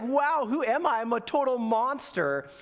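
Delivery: high-pitched, hammed-up self-reproach; appalled at herself. Friend realizing she failed, imitated self-disgust. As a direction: act out dismay at your own behavior. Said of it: "Like, super fail".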